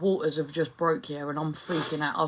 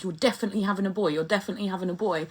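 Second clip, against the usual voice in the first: fast insistent quote; much faster than usual. Retold or imitated. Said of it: "all these people are like".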